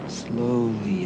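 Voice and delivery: usual speaking voice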